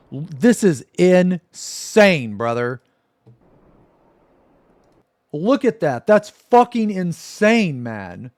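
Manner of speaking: high, loud, slow incredulous exclaim; can't believe what he's seeing; stunned outrage; outraged disbelief. Real, not acted. Streamer outraged at mobile wings.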